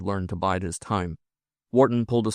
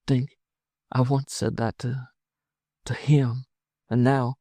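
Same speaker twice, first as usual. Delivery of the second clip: higher voice, broken by pauses; falter, each phrase hesitant; faltering woman; 5 semitones higher than usual. Acted. Wife recalling vows to her husband, hesitant and troubled.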